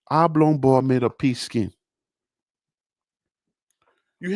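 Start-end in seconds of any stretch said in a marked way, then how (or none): 0.1-1.7 much lower, softer catchphrase; low aside voice. Acted. Low quiet catchphrase to viewers. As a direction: drop low and soft for the catchphrase.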